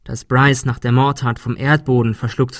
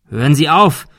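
raised, loud shout; urgent loud call; 4 semitones higher, 6 dB louder than usual. A narrator's reading. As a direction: shout to make him stop. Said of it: "rief Thomas".

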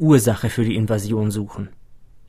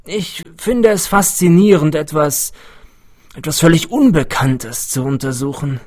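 higher, animated character voice; eccentric older scientist, fascinated; 5 semitones higher, 5 dB louder than usual. A narrator's reading. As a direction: marvel aloud at your research subject.

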